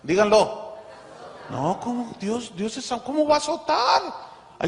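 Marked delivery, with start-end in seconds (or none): 1.5-4.0 mock indignation. Mocking believers' objection.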